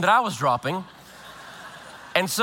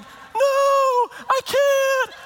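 high, crying mock wail; wife refusing to vomit, high mock wail; panicked refusal; 3 dB louder, much slower than usual. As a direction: cry out refusing in fear.

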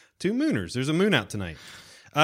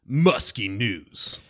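slow, drawn-out jingle-like announcer; segment announcement; much slower than usual. Acted. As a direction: stretch out each word to announce the segment. Announcing a named show segment.

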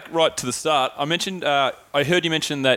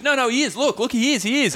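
put-on high-pitched girl voice; a higher voice for the friend insisting; imitating Jen; 9 semitones higher than usual. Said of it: "She goes".

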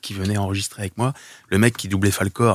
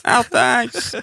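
calling out; 4 dB louder than usual. Acted. Calling out to lost horse.

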